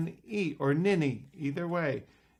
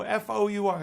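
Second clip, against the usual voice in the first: high, loud rapid letters in "F O U R"; insistent correction; 7 semitones higher, 6 dB louder than usual. Acted. Kids correcting the magician's spelling, insistent. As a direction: fire off "F O U R" as a correction.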